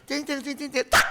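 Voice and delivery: high playful cing cing then tak; preacher sounding out the doctor's needle, high and playful; needle sound effect. Acted. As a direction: make the injection sound.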